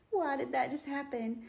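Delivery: lightly acted tearful disbelief; mock tearful. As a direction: retell it as a bewildered, teary question. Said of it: "starts crying. And she's like".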